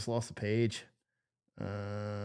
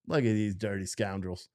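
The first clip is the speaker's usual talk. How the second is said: usual speaking voice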